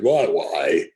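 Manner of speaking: put-on low, loud northern English accent; Ray Hill's northern accent. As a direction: boom it out in a strong northern accent. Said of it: "in his very northern accent".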